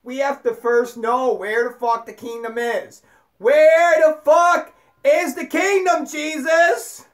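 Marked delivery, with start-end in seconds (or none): 3.4-7.1 loud, high, drawn-out demand; mock demanding shout. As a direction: holler it slowly as an exasperated question. Acted demand put to Jesus.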